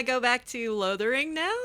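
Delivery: ordinary talk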